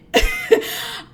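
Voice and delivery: high short laugh; real high-pitched chuckle. Real, not acted. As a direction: break into a quick high laugh. Laughs while describing getting mad.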